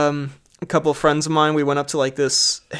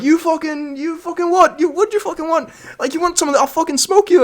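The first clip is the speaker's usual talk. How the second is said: high-pitched mock Irish yelling, no real volume; yelling Irish accent; 11 semitones higher than usual. Lads yelling for twenty minutes, high Irish accent. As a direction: bluster as an Irish lad threatening a fight. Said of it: "like, yelling".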